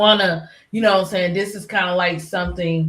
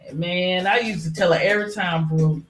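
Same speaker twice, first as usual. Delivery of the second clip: normal talking voice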